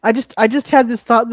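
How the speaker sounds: usual speaking voice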